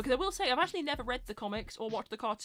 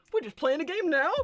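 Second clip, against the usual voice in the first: mock excited announcement; 6 semitones higher, 8 dB louder than usual. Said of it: "be like".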